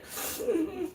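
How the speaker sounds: a short wet laugh as the tears come; laugh through tears. Real, not acted. Woman moved by her own words, half laughing half crying. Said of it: "Oh, look, I'm crying".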